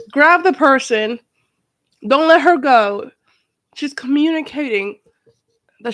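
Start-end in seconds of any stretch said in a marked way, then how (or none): 0.1-3.1 louder and slow, each word driven home; slow it down and press it like an order; urgent loud plea. Real, not acted. Yelling at the mother on screen, loud and slow.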